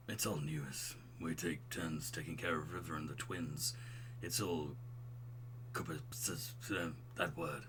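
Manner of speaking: low, soft dude voice; smooth, buttery bro. Acted. Divorced dad explaining childcare to a friend, smooth low voice. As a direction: keep it deep and subdued. Plays the character Craig.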